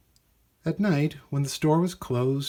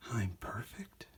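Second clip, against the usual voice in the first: much softer, wondering; robot's thought; 12 dB quieter than usual. Acted. Clink thinking to himself in wonder, soft. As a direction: hush it like a private disbelieving thought.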